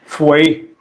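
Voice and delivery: "three" said with an F, as "free"; Cockney "three", TH turned to F. Shown as a demonstration. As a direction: start "three" with an f sound. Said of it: "replace them with Fs".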